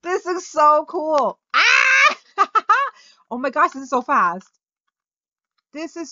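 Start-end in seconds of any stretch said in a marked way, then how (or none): 0.0-1.4 higher, louder and slow, savouring so cool; excited exclaim. Real, not acted. Riding the new vehicle. 1.5-2.2 very high, loud squeal of delight; thrilled scream. Real, not acted. Fast ride in game.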